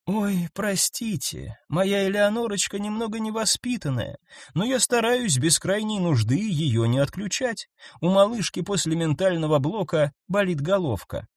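sweet little telepath apologising; gentle creature voice. A narrator's reading.

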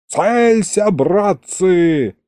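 high, drawn-out military order; shouted command. A narrator's reading. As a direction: call the order out to your men. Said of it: "скомандовал кто-то из защитников". (командный выкрик)

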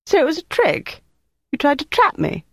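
high, indignant disbelief; outraged at being tricked. Acted. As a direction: voice jumps up in shocked protest.

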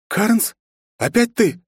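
high annoyed greeting; frowning. A narrator's reading. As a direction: grumble at an old rival. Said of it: "нахмурилась принцесса". (недовольно)

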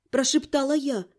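ordinary delivery

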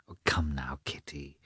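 soft unvoiced coaxing whisper; hushed sisterly coaxing. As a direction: breathe it gently to her sister. Sister gently coaxing Kitty.

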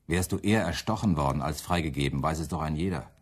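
normal talking voice

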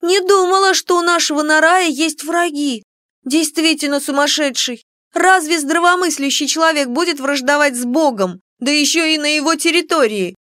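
put-on high, bright-eyed young friend; lively girlfriend voice. A narrator's reading. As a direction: chatter as the curious friend. Kiko's voice, narrated dialogue.

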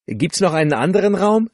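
high, loud called question. A narrator's reading. Luisa asks Dwayne over the din, calling loudly. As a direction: shout the question over the crowd. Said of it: "rief sie".

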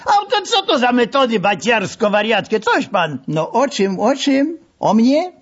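high, squealing indignant protest; mock pained protest. A narrator's reading. Narrator protests to the masseur, squealing. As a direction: squeal as the grip tightens.

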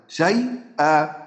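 شيئا drawn out very slowly; slow recitation. Shown as a demonstration. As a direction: stretch شيئا syllable by syllable. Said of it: "نطبق عليها القاعدة".

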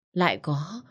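usual speaking voice

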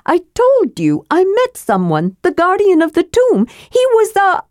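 sly kender explaining the way to the rune; Tass the kender. Acted.

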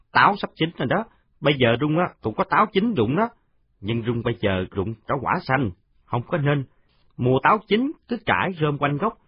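put-on high, chatty kid's voice; showing-off boy. Còi shows the new boy the apple trees, high and proud. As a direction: brag about the apples.

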